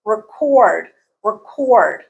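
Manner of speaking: "record" said re-CORD, each time separate; verb stress on second syllable. Shown as a demonstration. Teacher repeating the verb form, second-syllable stress. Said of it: "stress changes from the first syllable to the second".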